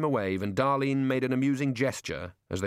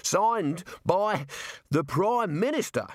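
high, puffed, broken phrases; fighting for breath; 8 semitones higher, slower than usual. A narrator's reading. Jailer catching up after running, struggling for breath. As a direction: manage it in bursts between breaths. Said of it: "fought for breath".

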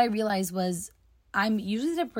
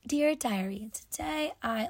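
mock diary voice; 4 semitones higher than usual. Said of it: "journaling doesn't need to be like".

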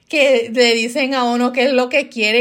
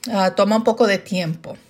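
ordinary delivery

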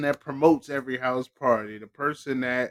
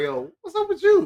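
mock parent. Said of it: "'cause they be like".

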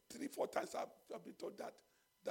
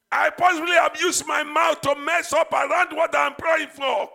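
loud, driving prayer leader; preaching at full force; 6 semitones higher, 24 dB louder than usual. Acted. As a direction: urge the church to cry out to God. Loud pleading prayer continues.

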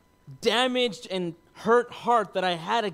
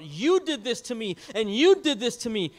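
emphatic, higher accusation, louder on YOU; retold accusation, hitting each YOU hard; accusing; 6 semitones higher, 6 dB louder than usual. Retold accusing first draft of letter.

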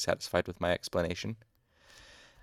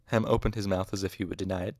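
ordinary delivery, nothing marked

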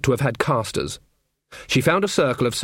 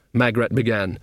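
usual speaking voice

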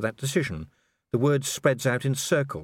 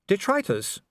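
high, gently chiding lady; a light reproving lady; Sybil voice; 10 semitones higher than usual. A narrator's reading. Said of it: "said Lady Sybil".

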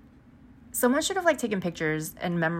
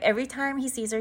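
normal talking voice

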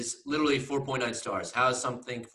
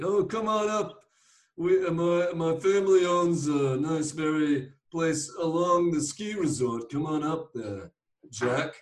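higher, louder mock Rhode Island uncle; uncle impression; 6 semitones higher, 3 dB louder than usual. Uncle inviting his nephew to the ski place, imitated voice. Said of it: "sorry for imitating your".

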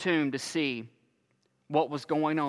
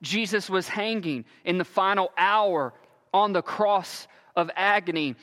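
ordinary talk

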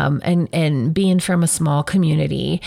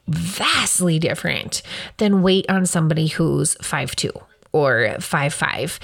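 normal talking voice

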